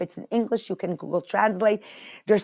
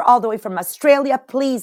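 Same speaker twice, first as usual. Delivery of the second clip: ordinary talk, nothing marked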